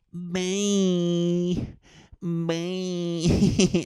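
high, loud singsong bye; cheerfully cruel brush-off, pitched high; mock cold dismissal. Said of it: "And I was like".

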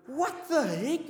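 high, drawn-out disbelief; hammed-up bewildered exclamation; acted astonishment. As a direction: stretch it slowly upward in shock. Acting own shock at gold dust.

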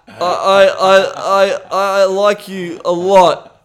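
hammed-up stammer, can't get the words out; mock choked-up stammer. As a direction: act out being too choked to say love. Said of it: "feeling this choke".

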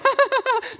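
high, forced nervous laughter; hammed-up awkward giggle; uncomfortable laugh. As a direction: laugh as if squirming with embarrassment. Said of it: "they just laugh really uncomfortably".

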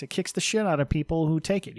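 usual speaking voice

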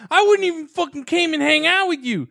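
high, loud mock shriek of an angry woman; imitating her yelling. Retelling a girlfriend screaming at him at three in the morning, mock high shriek. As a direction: shriek it out like a furious woken girlfriend. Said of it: "She's like".

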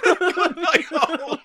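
squeaky slow laughing echo; laughing high repeat. Real, not acted. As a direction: giggle it out high.

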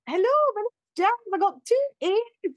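"two E's" said in a put-on Scottish accent, high and slow; mock Scottish accent. Student phoning a university, faking a Scottish accent. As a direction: fake a Scottish accent on the phone. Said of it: "pretend to be Scottish".

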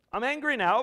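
loud, high declaration of anger; angry God. Acted. God declaring anger to faithless Israel, loud. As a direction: thunder it out as God. Preacher voicing God's anger.

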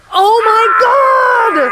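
drawn-out, high, loud cry of shock; stunned outcry. Retold or imitated. Retelling how she reacted to the fake tears, shrieking in shock. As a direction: shriek it out in slow disbelief.

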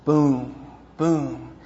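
ordinary delivery

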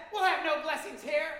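high, loud outraged witch cry; furious witch. Acted. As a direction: spit the word blessings like a curse. Witch enraged by blessings.

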